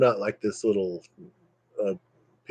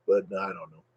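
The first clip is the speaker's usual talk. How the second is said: ordinary delivery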